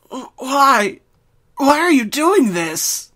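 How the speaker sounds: high, loud, slowed plea; frantic cry. Acted. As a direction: cry out in pain and panic. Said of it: "pained and frantic cry".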